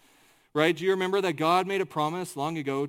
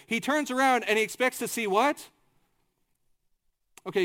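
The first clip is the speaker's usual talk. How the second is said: usual speaking voice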